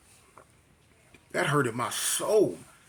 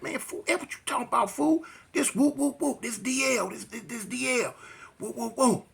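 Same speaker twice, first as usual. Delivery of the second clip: higher, fast jeering street taunt; mock gang taunt; 5 semitones higher, 4 dB quieter than usual. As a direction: rile him up with the gang chant. Gang member taunts teller.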